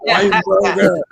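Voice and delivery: loud, high hammed-up TV host; skit host voice. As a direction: call him out like a game-show host. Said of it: "and they said".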